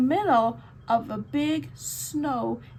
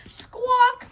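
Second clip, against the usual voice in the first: high, loud bird squawk; mock seabird cry; gull squawk; 11 semitones higher, 6 dB louder than usual.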